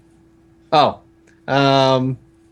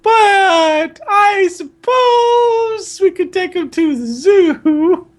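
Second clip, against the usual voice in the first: hammed-up wailing falsetto, at half speed; slow Aunt Petunia; 4 dB louder, much slower than usual. Aunt repeating herself once more, exaggeratedly slow falsetto. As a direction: stretch each word absurdly long, high and slow. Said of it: "said Aunt Petunia slowly".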